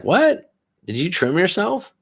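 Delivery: high-pitched, shocked "what?"; incredulous questioning. Retold or imitated. Wife questioning her husband about his trim, incredulous. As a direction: mimic a partner's disbelieving question, pitch shooting up.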